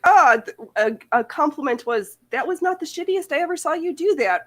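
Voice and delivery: hammed-up backhanded compliment; lightly voiced stern Slavic elder; mock Slavic elder. Mocking harsh Slavic compliment.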